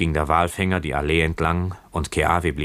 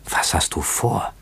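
plain reading voice

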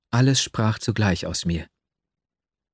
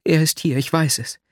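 higher, pressing on; agitated; 5 semitones higher than usual. A narrator's reading. Nastjenka agitated, telling her lover's promise. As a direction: tell it all in an agitated rush.

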